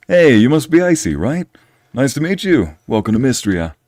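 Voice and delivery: high, cheery villager greeting; friendly carpenter. Acted. Carpenter greeting Icy to Mystria, high and friendly. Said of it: "This is the guy who made my bed".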